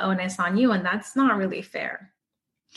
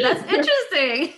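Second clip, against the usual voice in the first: laughing; 11 semitones higher than usual. Real, not acted. Amused reaction.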